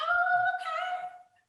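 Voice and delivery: high, slow sing-song chorus; sing-song kids. Retold or imitated. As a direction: sing it like obedient children. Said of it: "they were like".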